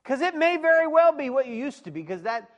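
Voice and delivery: high, loud preaching cry; preaching shout. Real, not acted. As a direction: belt the point at the pews.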